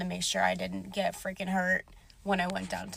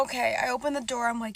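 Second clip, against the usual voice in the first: ordinary delivery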